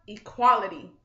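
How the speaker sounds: loud blurted equality; yelled out. A narrator's reading. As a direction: shout the word before you can stop. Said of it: "yelled out a phrase".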